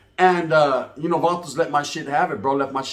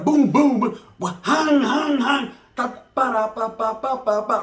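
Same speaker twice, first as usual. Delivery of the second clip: high, rapid-fire vocal gunshots; imitated gunfire booms and bursts; 8 semitones higher, slower than usual. House getting shot up, imitated gunfire. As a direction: mouth the shots going off like fireworks. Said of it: "shit's going crazy like fireworks".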